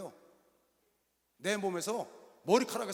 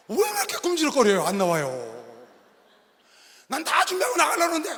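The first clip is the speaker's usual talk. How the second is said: higher, louder exasperated nagging; impatient scolding; 7 semitones higher, 10 dB louder than usual. Retold or imitated. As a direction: hurry her up, fed up. Impatient husband waiting for wife.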